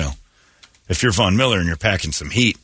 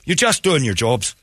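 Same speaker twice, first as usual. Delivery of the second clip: put-on Irish accent; 8 semitones higher than usual. Flattering the arresting police, hammed-up Irish accent. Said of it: "go into your Irish accent".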